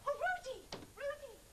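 high, anxious cry of a name; worried, high-pitched appeal; distressed calling. Acted. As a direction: call after him in dismay. Calls after the frantic man.

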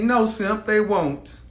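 ordinary talk

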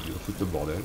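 ordinary talk, nothing marked

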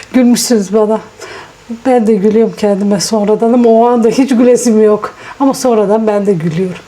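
ordinary delivery

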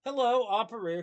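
high, loud operator singsong; high-pitched phone-operator greeting; telephone operator. A narrator's reading. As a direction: answer the switchboard brightly. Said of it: "came Lottie's voice".

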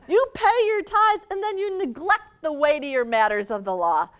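loud, high rebuke with a laugh in it; angry Jesus. Acted. Jesus rebuking the Pharisees, loud with a laugh. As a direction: scold them, half laughing. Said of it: "the seriousness of his anger".

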